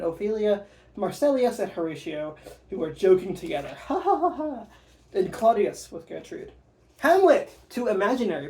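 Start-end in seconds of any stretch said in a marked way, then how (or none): 3.9-4.7 hearty mock laugh. Courtiers joking together.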